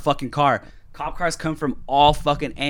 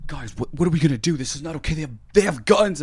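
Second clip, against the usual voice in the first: higher-pitched imitation of a panicking kid; mock panic; 5 semitones higher than usual. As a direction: act out a kid freaking out. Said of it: "He's, like, freaking out".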